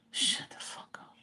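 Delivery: unvoiced whisper, slow. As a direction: hiss it under your breath. What he'd like to say.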